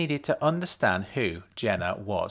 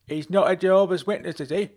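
raised, higher call from another room; bellowed; 8 semitones higher, 3 dB louder than usual. A narrator's reading. Husband bellows back to his wife from inside. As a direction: bellow it back through the house. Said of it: "a man's voice bellowed back".

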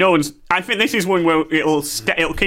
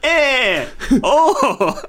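high, faltering attempt at a Mexican accent; 9 semitones higher than usual. Second go at greeting Mexican listeners, attempted Mexican accent. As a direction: grope badly for a Mexican accent. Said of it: "I'm the worst at accents".

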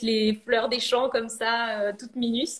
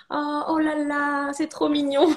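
higher, slow, cooing "oh là là"; a ceramicist imitates Italians hearing her brand name, higher and slower; Italians imitating French; 5 semitones higher than usual. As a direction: mimic Italians gushing over French charm. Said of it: "ça leur rappelle vraiment, euh, les Français".

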